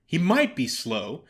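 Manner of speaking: usual speaking voice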